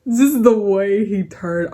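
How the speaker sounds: slow words squeezed out between real laughs; laughing through words. Real, not acted. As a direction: still laughing too hard to talk. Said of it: "I've never laughed so loudly and hard".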